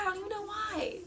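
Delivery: fighting back sobs; real crying, half laughing at herself; about to cry. Real, not acted. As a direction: voice breaking into tears. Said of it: "I'm, like, about to cry".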